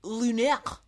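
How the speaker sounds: French accent put on, as if mouth full; mouthy fake French; mock French accent. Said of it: "sounds like you have something in your mouth".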